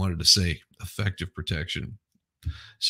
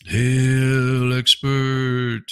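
higher, louder hammed-up hail; mock reverent hail; 6 semitones higher, 6 dB louder, much slower than usual. Greeting the expert, a hammed-up herald's hail.

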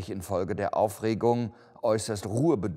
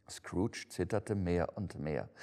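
plain reading voice

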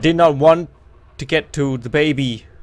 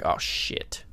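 hushed whisper; whispered panic; 6 dB quieter than usual. Real, not acted. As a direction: breathe it in panic.

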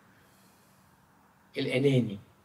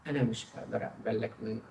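ordinary talk

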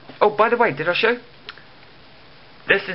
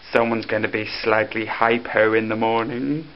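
ordinary talk

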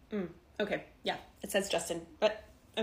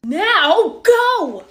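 loud command leaping up high; urgent shout; 5 semitones higher, 10 dB louder than usual. Acted. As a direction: yell it to hurry them off. Manager orders employee to go now.

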